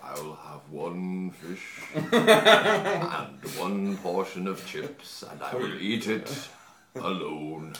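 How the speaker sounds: slow, drawn-out, low statesman's delivery; Winston Churchill. Retold or imitated. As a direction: order chips like a wartime orator, very slowly. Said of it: "thinks of Winston Churchill".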